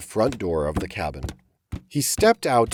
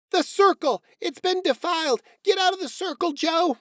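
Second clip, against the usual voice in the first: much higher, alarmed mother's voice; alarmed cry. Acted. Lucy Mack cries out to her son, alarmed and much higher. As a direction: cry out in alarm at the broken circle. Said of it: "Lucy Mack cried out".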